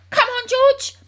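loud, high rallying call; eager father taking charge noisily; excited cry. A narrator's reading. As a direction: urge him on, raring to go. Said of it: "cried Mister Cranky, leaping up".

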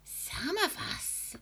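high, slow, breathy throaty whisper. A narrator's reading. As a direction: lean in and whisper hoarsely. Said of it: "whispering in a throaty sort of voice".

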